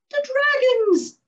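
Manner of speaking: high, loud, slow cry of fear; frightened cry. A narrator's reading. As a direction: shriek it out in horror. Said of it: "cried the birch".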